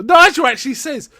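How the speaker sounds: usual speaking voice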